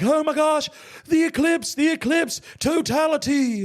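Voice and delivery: very high, loud fake gushing; hammed-up squeal of eclipse mania; mock excitement. Mock excitement he says he lacked.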